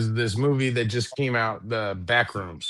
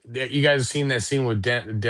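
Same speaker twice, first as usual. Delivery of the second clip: ordinary delivery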